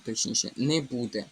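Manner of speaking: ordinary delivery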